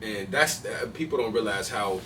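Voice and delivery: ordinary talk, nothing marked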